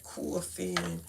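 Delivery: "coughing" in thick Brooklyn vowels; Brooklyn accent. Real, not acted. Mocking own cough word to viewers, Brooklyn accent. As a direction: lean into the Brooklyn "cawfin". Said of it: "can't get rid of that Brooklyn accent".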